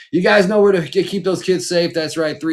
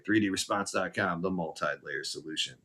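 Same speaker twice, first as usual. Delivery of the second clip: quiet sign-off; 6 semitones lower, 10 dB quieter than usual. Real, not acted. Quiet repeat of tagline.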